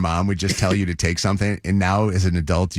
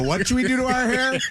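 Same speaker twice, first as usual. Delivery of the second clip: high-pitched teasing question; teasing kids. Retold or imitated. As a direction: imitate giggly children baiting their dad. Kids teasing dad in chorus.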